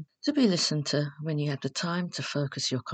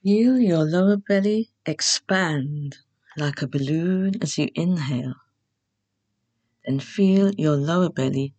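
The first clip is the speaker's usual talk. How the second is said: guided meditation; 4 dB louder than usual. Acted. Host leading beginners through Yoga Nidra, calm guided meditation. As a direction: guide them unhurriedly into rest, phrase by phrase.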